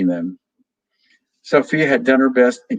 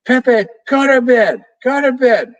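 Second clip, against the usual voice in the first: much higher, loud scream; 13 semitones higher, 5 dB louder than usual. A narrator's reading. Little Vero, really mad, screams at parrot Pepe to go to bed. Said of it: "and she screamed".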